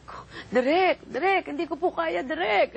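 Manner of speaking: begging the director to be spared; pleading with director. Retold or imitated. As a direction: beg the director, voice climbing.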